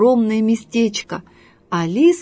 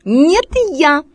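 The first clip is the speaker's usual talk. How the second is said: high, loud fox protest; sharp, raised objection; indignant squeal; 6 semitones higher, 5 dB louder than usual. Acted. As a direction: squeal back in outrage. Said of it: "возмущённо завизжала лиса".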